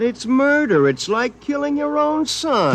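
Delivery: high, desperate pleading; panicked plea. Acted. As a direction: beg for your life. Pleading against being melted.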